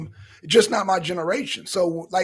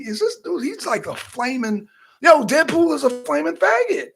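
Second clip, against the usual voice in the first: higher mock outraged quote; imitating his own shocked take, pitched up; retold reaction; 7 semitones higher than usual. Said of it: "I'm like".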